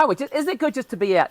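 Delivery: high, loud and fast delighted rush; retold joy of being out again; excited thought. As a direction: gush like a fan overwhelmed at the stadium. Recalling joy at stadium opening.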